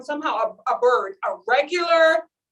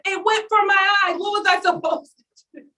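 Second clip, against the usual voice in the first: higher, indignant mother voice; mock mom; 8 semitones higher than usual. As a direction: ham up her outrage. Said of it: "She was like".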